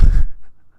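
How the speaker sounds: sudden loud burst of laughter; self-amused laugh. Real, not acted. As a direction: laugh out loud at your own silliness. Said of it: "and then I laugh".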